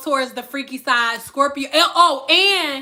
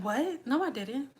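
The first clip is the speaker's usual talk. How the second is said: soft feigned innocence; calm denial; 11 dB quieter than usual. Acted. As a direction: deny it quietly, as if unbothered. Acting out a caught Scorpio denying.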